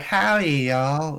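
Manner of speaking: slow, high American "howdy" drawl; badly faked southern American twang; mock American accent. Shown as a demonstration. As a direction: put on a drawn-out American cowboy accent. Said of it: "think about your best American accent".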